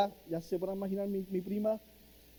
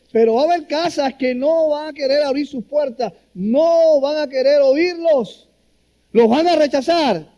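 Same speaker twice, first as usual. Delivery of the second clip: higher, louder voicing of Jesus giving orders; a lightly voiced Jesus warning his disciples, building louder; Jesus instructing; 8 semitones higher, 7 dB louder than usual.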